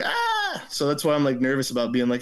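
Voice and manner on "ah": high-pitched mock scream of dread; retold scream. Storyteller recalling being mistaken for the culprit, mock scream. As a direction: let out a thin panicked "ah". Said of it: "kinda was like".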